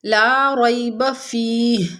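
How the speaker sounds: ला रैब फीह slow, joined, stopping on फीह; stop on फीह. Shown as a demonstration. Teacher demonstrating a stop at फीह. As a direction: recite ला रैब फीह slowly and pause at फीह. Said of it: "फीह पर वक्फ़ किया".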